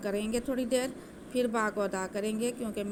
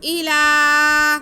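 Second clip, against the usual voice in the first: slow, drawn-out melodic tajweed recitation, higher and louder; Quran recitation; 5 semitones higher, 11 dB louder, much slower than usual. Shown as a demonstration. Teacher demonstrating the recitation to students, slow melodic chant. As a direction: chant it slowly with nasal ghunna. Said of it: "चार अलिफ के बराबर खींच के पढ़ेंगे".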